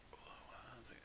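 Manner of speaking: whispered aside, no voice. Real, not acted. GM whispering to the table mid-session. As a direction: hush it to the table so the mic misses it.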